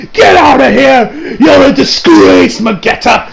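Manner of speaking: high, slow, contemptuous yell; angry scolding. Retold or imitated. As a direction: banish him in mock fury. Scorning Magetta as a coward.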